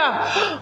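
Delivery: a sharp gasp for air; gasping breath demo. Shown as a demonstration. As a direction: gasp like someone struggling to breathe. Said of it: "So that when you hear".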